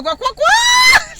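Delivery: very high, loud, slow shouted quacks; shrieked quacking. Retold or imitated. Children taunting the girl at lunch, shrieked high quacking. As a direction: shriek the quacks like a mob of kids. Imitating kids' chicken dance.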